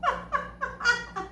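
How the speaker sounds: laughing at the memory; embarrassed laugh. Real, not acted. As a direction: burst of laughter at her own gaffe. Laughs at own blunder.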